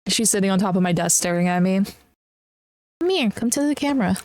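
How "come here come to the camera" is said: high, quick coaxing call; pet talk. Real, not acted. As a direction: coax the pet closer, sing-song.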